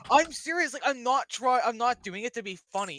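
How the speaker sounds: loud, high insistence; emphatic protest. Real, not acted. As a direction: protest earnestly that you mean it. Insists he is serious.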